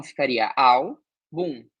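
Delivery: al and bum said apart, with a pause between; split into syllables. Shown as a demonstration. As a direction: break álbum into al, then bum. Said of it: "se a gente fizesse separação". ("al" e "bum" separados em sílabas)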